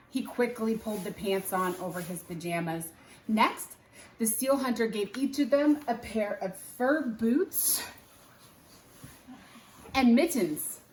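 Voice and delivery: usual speaking voice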